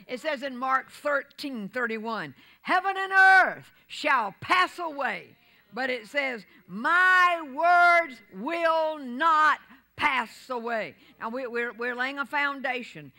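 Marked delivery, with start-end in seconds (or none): 2.6-5.4 higher, louder, slow scripture call; preaching shout. Real, not acted. 6.7-11.0 very slow, higher, louder proclamation; preaching shout. Real, not acted.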